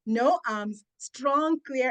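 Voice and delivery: normal talking voice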